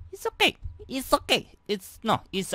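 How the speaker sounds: high squeaky reassurance. Acted.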